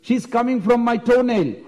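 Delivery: high-pitched proud challenge; hammed-up haughty boast; arrogant boasting. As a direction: scoff as if far above it all. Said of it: "very arrogant, very proudly".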